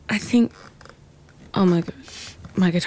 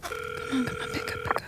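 anxious mutter; 9 dB quieter than usual. Acted. Urging unanswered phone call.

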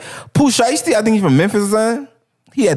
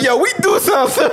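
high, laughing imitation of a Baltimore speaker; mock Baltimore accent; 7 semitones higher than usual. Friend retells the Baltimore reading clip, high mocking imitation. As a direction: mimic the Baltimore guy, pitched up and laughing. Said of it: "that motherfucker like".

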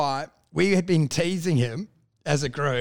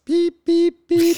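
high, loud electronic beeps; sharp mock beeping sounds; beep imitation; 11 semitones higher, 7 dB louder, much slower than usual. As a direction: mimic the beep test tones.

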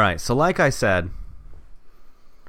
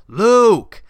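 loud high scream of a name; boss screaming; 8 semitones higher, 6 dB louder than usual. Acted. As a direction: scream his name across the office. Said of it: "he heard his boss scream".

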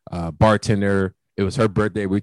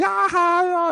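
mock girly laugh; slower than usual. Girls laughing at him.

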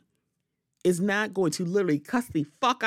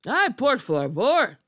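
ay por favor; 5 semitones higher, 4 dB louder, slower than usual. Real, not acted. Exasperated Spanish exclamation.

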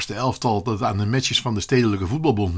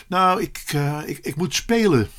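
halting, higher, reluctant confession; hesitant admission; 4 semitones higher than usual. Acted. Boy afraid of seeming boastful to friend, hesitant. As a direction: stumble towards the news bit by bit.

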